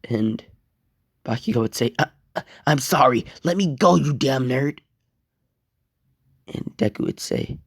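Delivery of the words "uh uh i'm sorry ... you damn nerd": hurried, higher-pitched plea; desperate, rushed outburst while being choked; panicked pleading. Acted. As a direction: beg frantically to be released. Choked victim begs for release.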